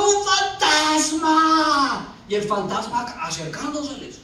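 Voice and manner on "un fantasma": drawn-out cry of alarm; hammed-up ghost sighting; mock frightened cry. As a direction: stretch every syllable as if crying out in fear. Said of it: "Todos le gritaban diciendo".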